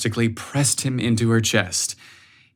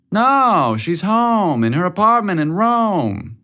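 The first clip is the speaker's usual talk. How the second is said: higher, correcting mom voice; mother's voice; 8 semitones higher, slower than usual. A narrator's reading. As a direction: mom setting him straight.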